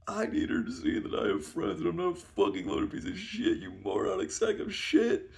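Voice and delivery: high-pitched exasperated tirade; acted angry rant. Berating friend who won't come along, acted anger. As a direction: rant in high, hammed-up outrage.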